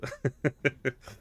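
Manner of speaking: high run of real giggles; tickled, bubbling chuckle; laughing. Real, not acted. Player amused by terrifying eyes, laughing.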